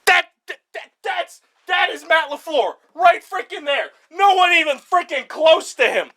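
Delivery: high, heated stammer into a shout; excited. Real, not acted. Fan pointing out the coach on the graph, heated and excited. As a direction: sputter with glee, then shout the name. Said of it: "I just get really passionate and heated".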